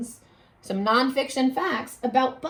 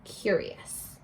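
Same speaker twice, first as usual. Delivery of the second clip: "Curious" whispered, voiceless and soft; hushed teacher whisper of "Curious"; whispered word; 3 semitones lower, 9 dB quieter than usual. Shown as a demonstration. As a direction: breathe "Curious" as a secret. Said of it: "that word curious means".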